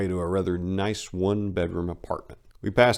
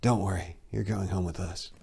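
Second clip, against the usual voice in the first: whispered; 7 dB quieter than usual. Retold or imitated. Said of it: "one of the ladies whispered".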